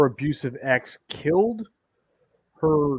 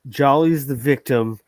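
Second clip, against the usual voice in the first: ordinary delivery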